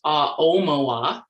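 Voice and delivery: "Aomowa" drawn out, stressing the O; name pronunciation demo. Shown as a demonstration. Speaker teaching the audience to pronounce her name, stress on O in "Aomowa". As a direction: say "Aomowa" slowly with emphasis on the O. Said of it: "The emphasis is on the O".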